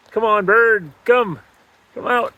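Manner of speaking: high, loud coaxing call; coaxing a wild bird. Real, not acted. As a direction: urge it out, pitched high. Urging trapped bird to come out.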